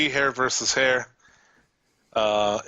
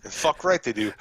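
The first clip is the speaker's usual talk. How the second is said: ordinary talk, nothing marked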